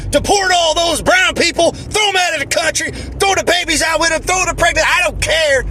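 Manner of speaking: high-pitched mock shouting; mock hateful rant.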